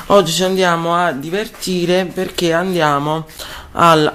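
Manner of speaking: ordinary delivery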